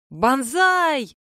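sudden high shrill yell of Banzai; yelling battle cry. Acted. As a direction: charge in screaming it. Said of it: "Вдруг заорала живоглотка".

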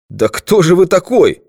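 high, loud demanding question; cornered man's high-pitched outburst; agitated challenge. A narrator's reading. Cornered man challenges the detective.

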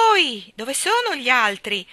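loud, drawn-out household call; shouting. A narrator's reading. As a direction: holler for the family while rushing in. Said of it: "gridò".

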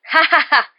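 high, loud mocking "ha ha ha"; crowing laugh. A narrator's reading. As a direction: laugh out loud at the dazed players. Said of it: "s'écria Lee Jordan". (rire moqueur et claironnant)

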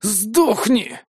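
high strained rasp of a wounded man; hoarse curse. Acted. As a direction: spit it out hoarsely. Wounded enemy curses the hero.

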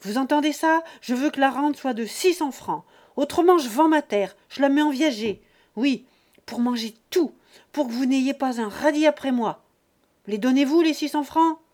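loud, high commanding shout; angry ultimatum. Acted. Father imposing six hundred francs on his children, shouting. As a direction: lay down the law.

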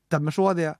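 plain reading voice

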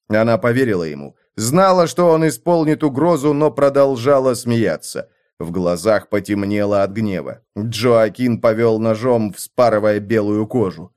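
ordinary delivery, nothing marked

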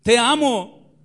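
loud, slow and stretched out; impassioned cry. Acted. Christ on the cross calling out his love, loud and slow. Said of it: "te está diciendo".

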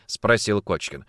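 ordinary delivery

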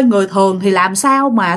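ordinary delivery, nothing marked

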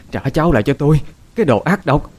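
high, loud wailing scream; screaming grandmother. A narrator's reading. Grandmother screaming at daughter-in-law over dead grandson, shrieking. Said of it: "Bà Lan gào thét".

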